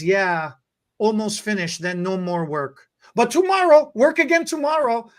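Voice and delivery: imitating the cashier's broken English, eager; foreign accent. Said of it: "thickest accent".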